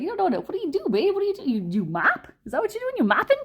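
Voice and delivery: very high mock New York accent; tough-guy accent. Boyfriend quizzing his girlfriend, incredulous high New York accent. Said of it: "He talks like this".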